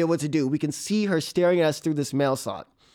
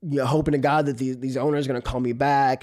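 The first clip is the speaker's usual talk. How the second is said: ordinary talk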